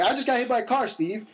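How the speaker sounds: injured rider calling up to his friend; retold call back. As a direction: shout up to the porch, half laughing at it. Shouting back to friend on porch.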